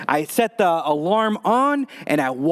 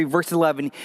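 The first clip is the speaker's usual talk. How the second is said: ordinary talk, nothing marked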